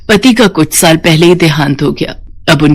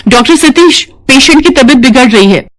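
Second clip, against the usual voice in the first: urgent, higher-pitched call; alarmed; 6 semitones higher than usual. Acted. Nurse alerting the doctor to a failing patient, alarmed.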